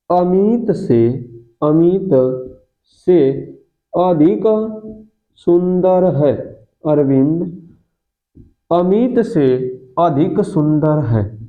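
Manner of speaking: word by word with pauses, slowly; slow dictation. Shown as a demonstration. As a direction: let the class write each word. Teacher dictating example sentence slowly.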